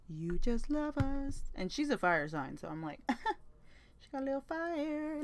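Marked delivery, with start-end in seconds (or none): 0.1-1.5 higher, slow singsong teasing; singsong tease. Pretend. Teasing her friend about loving Tauruses, high and slow singsong. 4.0-5.2 higher, soft playful voice; playful tease. Pretend. Joking about her friend being a fire sign, higher and playful.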